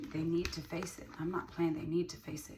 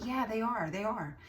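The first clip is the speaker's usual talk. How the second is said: voicing ChatGPT; 6 semitones higher, 4 dB louder than usual. ChatGPT voice agreeing.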